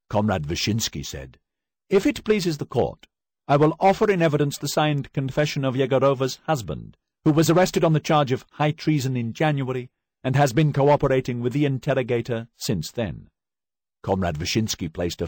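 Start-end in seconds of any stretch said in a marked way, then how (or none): 1.9-13.3 loud, high, formal reading of the charges; stern prosecutor. Acted. Prosecutor reading charges to the accused, loud and formal. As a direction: announce the indictment to the whole court.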